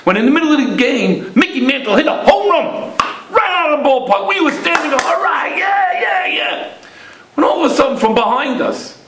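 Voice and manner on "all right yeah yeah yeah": high, shouted stadium cheer. Retold or imitated. Boy and father cheering Mantle's home run, excited shout. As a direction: whoop it up like a fan in the stands.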